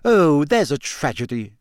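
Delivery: mildly snappy sarcasm; a touch of scornful impatience; snapped. A narrator's reading. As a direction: snap it out with mock sympathy. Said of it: "Hermione snapped".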